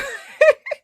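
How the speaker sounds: loud high burst of laughter. Real, not acted. Streamer laughing loudly before greeting Maya.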